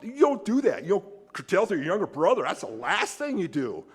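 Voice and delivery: a petulant big brother digging in his heels; mock self-righteous grumble; sulky older brother. Preacher voices sulky older brother.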